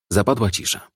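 ordinary delivery

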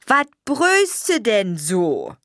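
put-on slow, old woman's Ruhr grumble; grumpy grandma. A narrator's reading. Grandma scolding the mother for yelling, slow grumble.

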